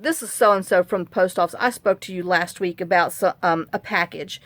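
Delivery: ordinary delivery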